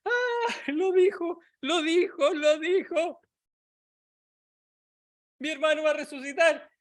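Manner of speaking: muy aguda y eufórica, repitiendo con alegría; Marta jubilosa. Retold or imitated. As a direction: salta de gozo al gritar que lo dijo. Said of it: "Marta puede regocijarse y saltar en una pata y decir". (grito alegre de Marta porque Jesús lo prometió)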